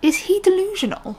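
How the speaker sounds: high, disbelieving question; incredulous. Real, not acted. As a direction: ask it in sheer disbelief. Outraged at teacher's remark.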